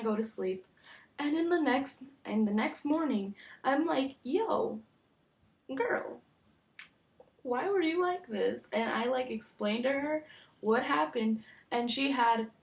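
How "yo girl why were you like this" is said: higher, disbelieving call-out; asking her friend about the night, incredulous; incredulous teasing. Retold or imitated. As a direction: call her out, amused and baffled. Confronting friend next morning.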